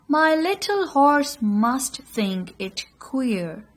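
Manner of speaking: slow, measured verse reading with lifted phrases; poem recitation. Shown as a demonstration. Teacher shows students how to read the poem, expressive recitation. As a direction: recite line by line, pausing between phrases.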